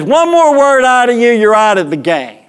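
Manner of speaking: high umpire warning, easing down at the end; mock yelling. Umpire threatens to eject the pitcher, mock-angry warning. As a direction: imitate an umpire issuing a last warning. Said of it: "we're yelling".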